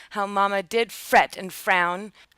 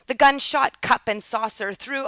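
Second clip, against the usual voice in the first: usual speaking voice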